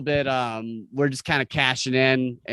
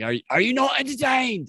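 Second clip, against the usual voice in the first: high, ringing arena challenge to the crowd; Gladiator quote; 11 semitones higher than usual. Retold or imitated. Friend quoting Gladiator's famous arena line, hammed-up and high. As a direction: throw the line out like a gladiator to the stands. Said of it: "when he's like".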